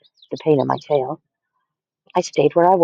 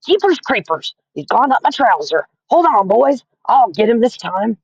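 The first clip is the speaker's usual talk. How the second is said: cook shouting; 7 semitones higher than usual. A narrator's reading. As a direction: yell it out in a panic. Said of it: "the cook shouted".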